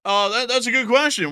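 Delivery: high squeaky critter voice on the first line; put-on animal character voice; a squeaky marmot answering.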